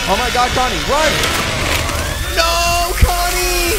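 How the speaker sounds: high, loud terrified shrieks; panicked screaming. Real, not acted. Gamer shrieking as the killer grabs her and Connie. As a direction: scream for your life as they catch you.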